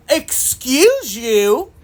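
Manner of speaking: slow, drawn-out, offended "Excuse you?"; indignant excuse you. Retold or imitated. Shopper who got barreled through, slow and indignant. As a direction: stretch it out like you can't believe their rudeness. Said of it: "I'm looking at them like".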